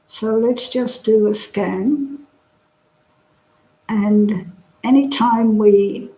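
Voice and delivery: calm, unhurried meditation guide; guided meditation. Real, not acted. Teacher settling an online class into body scan, guided meditation. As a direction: talk them gently through relaxing, with long pauses.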